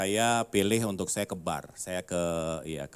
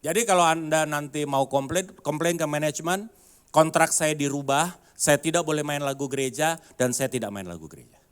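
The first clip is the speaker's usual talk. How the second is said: pianist's firm reply; 5 semitones higher, 5 dB louder than usual. Retold or imitated.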